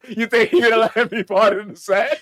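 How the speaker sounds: normal talking voice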